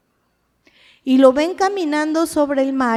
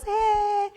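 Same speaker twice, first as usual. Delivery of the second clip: high, soft drawn-out mock cheer; 9 semitones higher, 5 dB quieter than usual. Imitates everyone cheering the child's arrival.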